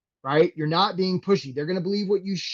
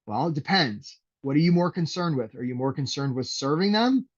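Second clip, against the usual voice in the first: ordinary talk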